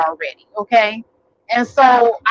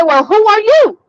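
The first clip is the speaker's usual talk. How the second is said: defiant; 9 semitones higher, 4 dB louder than usual. Retold or imitated. Caller's defiant retort.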